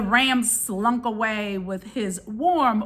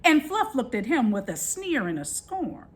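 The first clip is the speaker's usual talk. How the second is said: ordinary delivery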